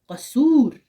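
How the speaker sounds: कसूर said loud and high with a long open oo; teacher stressing the open oo in कसूर; open waw demo. Shown as a demonstration. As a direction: ring out the oo in कसूर. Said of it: "गो इसको हम वाव मारूफ कहते हैं".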